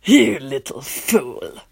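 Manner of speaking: low, slow, calm scorn; calm contempt. A narrator's reading. Vampire prince scorning the boy, calm and low. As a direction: calmly belittle him. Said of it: "he says in a calm voice".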